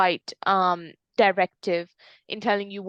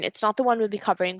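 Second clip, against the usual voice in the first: ordinary talk, nothing marked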